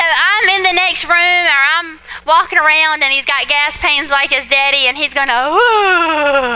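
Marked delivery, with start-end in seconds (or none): 5.5-6.6 put-on whimpering baby ooh, pitched up; imitated baby cry. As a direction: copy the baby's pained little cry. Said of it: "it's not your normal cry".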